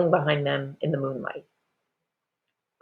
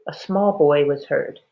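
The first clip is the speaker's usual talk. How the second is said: usual speaking voice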